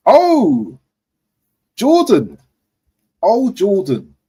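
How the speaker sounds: high, loud drawn-out disbelief; surprised groan. Real, not acted. Fan reacting to Henderson's move, disbelieving. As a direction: cry out his name in disbelief.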